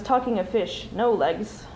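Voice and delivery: ordinary delivery, nothing marked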